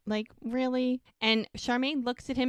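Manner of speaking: ordinary talk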